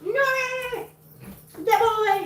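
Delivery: very high excited call. Real, not acted. Owner calls out high and excited to the dogs.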